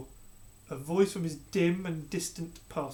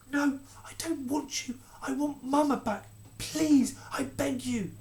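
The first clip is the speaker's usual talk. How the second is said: tearful refusal; 9 semitones higher, slower than usual. Acted. Boy refusing comfort.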